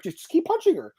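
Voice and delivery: very high-pitched mock yell; strained high imitation of a pleading coach; imitated coach's scream. Coach telling his fighter to keep punching, high imitated scream. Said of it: "he was screaming at her".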